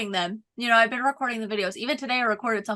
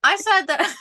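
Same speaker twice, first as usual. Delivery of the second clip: laughing; 9 semitones higher, 4 dB louder, slower than usual. Real, not acted. Embarrassed over a slip.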